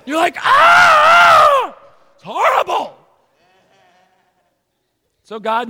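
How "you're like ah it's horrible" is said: high, loud shriek of disgust. Retold or imitated. Pastor voicing a grossed-out dog watcher, loud shriek. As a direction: squeal like you can't bear to look.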